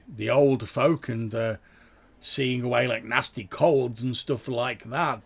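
a village priest modestly offering herbs; modest vicar. Acted. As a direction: speak as the kindly chubby vicar. GM voices Father Drabe.

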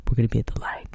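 hushed conspiratorial whisper; whispered aside to a college girl. Retold or imitated. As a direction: breathe it like a secret meeting spot. Secret meeting place, hushed.

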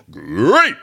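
rolled, growled cartoon-mascot "great"; Tony the Tiger. Teacher quoting a mascot to explain great, growly cheer. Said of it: "the Tony the Tiger".

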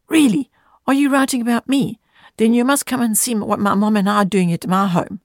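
put-on high, eager child voice; lightly voiced excited young boy. A narrator's reading. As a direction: bubble over like a delighted little boy. Boy Bobby excited in story.

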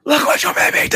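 forced whisper, high and loud; a whisper pushed out as a shout; loud stage whisper. Pretend. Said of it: "in the best whisper voice possible".

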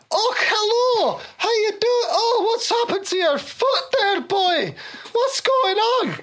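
high, drawn-out nurse voice. Acted. Game master as the nurse greeting an injured man, high-pitched cry. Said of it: "a nurse runs out to you".

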